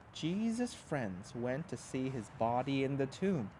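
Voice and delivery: plain reading voice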